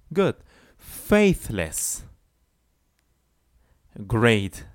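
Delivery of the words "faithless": "Faithless" said clearly, a bit higher; model pronunciation. Shown as a demonstration. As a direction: pronounce "Faithless" carefully. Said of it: "practice with me the pronunciation".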